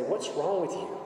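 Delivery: a fed-up driver snapping at a screaming motorist; exasperated retort. Retold or imitated. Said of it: "wanted to roll down my window and say".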